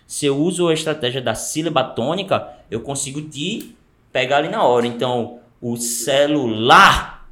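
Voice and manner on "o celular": stretched very slow and louder; slow, loud persuasive demo. Shown as a demonstration. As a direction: drag the words out to grab attention. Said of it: "o bicho deu uma subida de voz ali".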